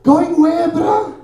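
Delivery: high, loud baffled retort; like you can't believe he's asking; exasperated disbelief. Retold or imitated. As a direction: rider on a motorbike scolding the taxi guard. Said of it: "I was like".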